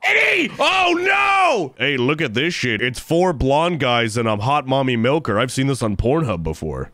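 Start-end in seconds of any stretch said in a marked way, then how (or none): 0.0-1.7 high panicked yell; startled, panicking; panicked shriek. Real, not acted. Panics at killer.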